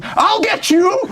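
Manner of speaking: high-pitched, hammed-up threat; mock threatening cry. Man threatens ghost with inside-out umbrella, hammed-up high cry. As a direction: cry it out like a comic attacker.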